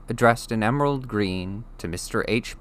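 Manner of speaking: ordinary delivery, nothing marked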